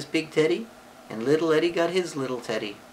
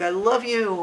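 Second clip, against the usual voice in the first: high-pitched happy cry; happy exclamation; 8 semitones higher, 4 dB louder than usual. Shown as a demonstration. Happy example for a child, exclaimed. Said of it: "it could be a happy thing like".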